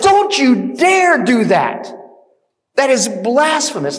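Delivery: loud, high preacher's rebuke; acted indignation. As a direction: forbid it with outraged force, leaning on each word. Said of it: "who are so indignant".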